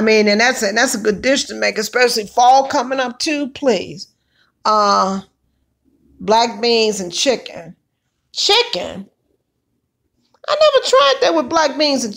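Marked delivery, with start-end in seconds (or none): none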